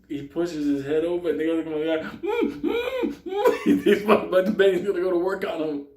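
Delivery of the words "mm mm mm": very high muffled moaning; terrified muffled moans. Retold or imitated. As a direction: moan in panic as scissors snip. Gagged man hears scissors.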